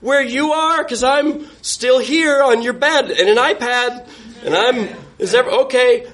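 high-pitched call toward another room; retold call to his date in the other room; calling out to her. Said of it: "I try shouting to her".

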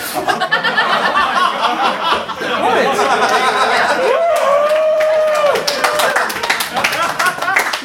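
high, long burst of real laughter; laughing helplessly for seconds. Real, not acted. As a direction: crack up at the memory. Long laugh at his own story.

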